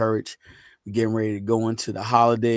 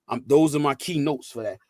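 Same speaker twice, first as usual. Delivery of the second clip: ordinary talk, nothing marked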